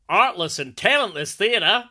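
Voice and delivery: a questioning, laboured attempt at Australian; attempted Australian accent. Reading Kaz in Melbourne's comment, in a put-on Australian accent. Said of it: "your Australian accent's making me laugh".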